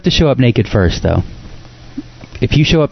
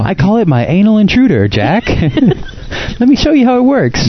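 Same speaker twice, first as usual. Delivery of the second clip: mock inventor voice; 9 semitones higher than usual. Acting inventor scene.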